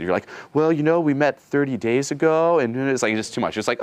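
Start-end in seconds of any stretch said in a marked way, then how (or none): 0.5-2.6 mock over-explaining guy, higher and slow; overinvested guy. Coach mocks a guy over-explaining by text, slow and higher. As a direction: ham up a too-eager explainer. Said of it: "'cause you're too invested".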